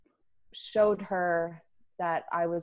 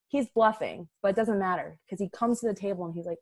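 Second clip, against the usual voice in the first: ordinary talk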